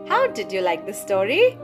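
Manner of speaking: usual speaking voice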